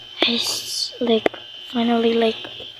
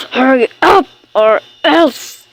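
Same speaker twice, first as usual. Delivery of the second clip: loud, impatient mom order; mock-stern loud warning; pretend threat; 6 dB louder than usual. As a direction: call it out as a cross play parent. Play mother threatens dawdling child.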